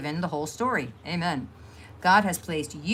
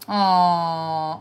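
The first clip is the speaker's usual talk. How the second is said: ordinary talk, nothing marked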